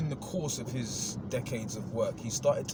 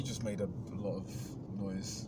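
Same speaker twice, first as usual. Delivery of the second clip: whisper; 5 semitones lower, 6 dB quieter, slower than usual. Real, not acted. Quiet aside after story.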